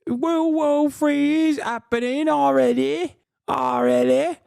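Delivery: high, loud mimicry of the man; mocking imitation. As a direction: parody him in a loud, high whine. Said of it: "He's like".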